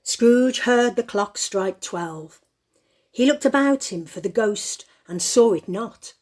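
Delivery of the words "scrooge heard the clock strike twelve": ordinary talk, nothing marked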